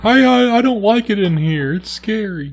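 high, put-on timid child; little kid voice. Trapped leprechaun answering from the crate, little kid voice. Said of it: "It's like a little kid voice".

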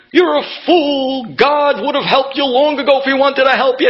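mock the fool with a high scornful voice; scornful doctor. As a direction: look down on him from a great height. Doctor mocking the believer.

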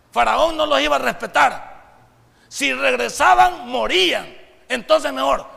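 preaching at full force. Acted. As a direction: preach it big, building to a shout. (predicando con toda la fuerza, en voz alta)

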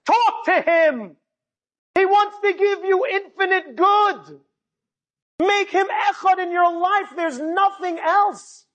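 high, slow, drawn-out exhortation; impassioned preaching. Real, not acted. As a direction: proclaim it with fervour to the crowd. Preaches the lesson at full force.

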